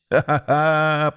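slow, acted ha-ha-ha; rolling laughter. A narrator's reading. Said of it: "покатывался Васильковский".

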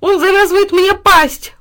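high, loud slow squeal; squealing dog. A narrator's reading. Dog squealing as his mouth is tied. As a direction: yelp it in panic. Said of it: "взвизгнул пёс".

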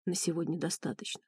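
low, hushed male voice; quiet. A narrator's reading. Ренат tells the grieving heroine to stop, quiet and low. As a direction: keep it soft and low. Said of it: "тихо сказал Ренат".

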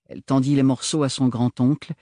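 ordinary delivery, nothing marked

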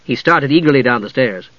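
plain reading voice

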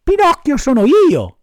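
high, loud proud cry; excited. Acted. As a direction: shout it out, thrilled. Puppet announces himself eagerly.

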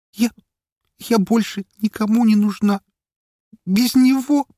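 put-on high, stammering, broken girl's voice; tearful despair. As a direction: stammer it out in despair, near tears. Suicidal girl tearfully stammers. (высоко, с отчаянием, сквозь слёзы)

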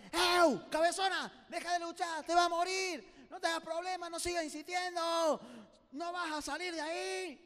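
high yell, endings stretched out; shouted discouragement. Acted. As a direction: holler down at the stubborn one to quit. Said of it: "le gritaban". (gritos alargados de desaliento)

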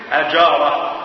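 أجارة with a on the first letter, "ajara"; أجارة first vowel a, the fatha reading. Shown as a demonstration. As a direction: say أجارة opening with a short a. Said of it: "وتقول".

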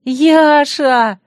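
high, loud, happy recognition; delighted. A narrator's reading. Bride recognises Yasha, anger turning to delight. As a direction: greet a friend with a broad smile. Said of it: "расплылась в улыбке я".

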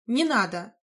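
plain reading voice

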